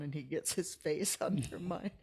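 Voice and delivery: normal talking voice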